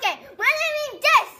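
high, loud, rushed protest; excited squeal. Real, not acted. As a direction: blurt it out high and fast. Kid protesting the tooth pull.

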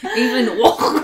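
slow name through giggles; laughing. Real, not acted. Laughing pronunciation.

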